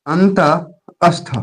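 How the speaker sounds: अंतःस्थ stretched slowly, each sound held; slow drawn-out term. Shown as a demonstration. Teacher drawing out अंतःस्थ for students to remember. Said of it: "याद रहे".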